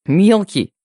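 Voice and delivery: huffy, affronted little-brother protest; displeased snort. A narrator's reading. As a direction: throw the nickname back, offended. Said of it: "недовольно фыркнул брат".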